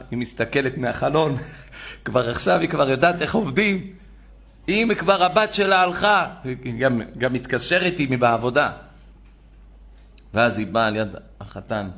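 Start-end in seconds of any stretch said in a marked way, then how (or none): none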